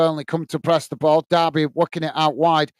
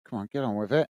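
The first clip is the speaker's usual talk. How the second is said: muttered aside; 7 semitones lower, 8 dB quieter, faster than usual. Real, not acted. Impatient aside waiting for corner.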